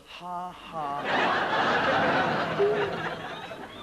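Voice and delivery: a deliberately fake laugh on cue; mock canned laugh. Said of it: "If you want to laugh, it goes".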